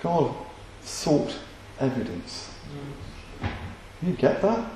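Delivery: a hushed emphatic reveal; hushed emphasis. Real, not acted. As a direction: drop almost to a whisper to land the point. Sermon point dropped to hush.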